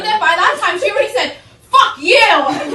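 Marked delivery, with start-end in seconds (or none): 1.7-2.8 loud retold outburst; really loud. Stranger cursing whoever bumped her in the station, loud. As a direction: blurt it out at full volume. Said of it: "really loudly in the train station".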